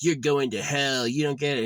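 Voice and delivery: a heckler taunting the vlogger, loud mock jeer. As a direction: sneer it like a heckler. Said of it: "someone, like, taunts me".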